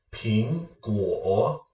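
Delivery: "ping" rising, "guo" dipping; second plus third tone. Shown as a demonstration. Teacher reviewing example words for viewers, rising then dipping. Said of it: "a second tone with a third tone".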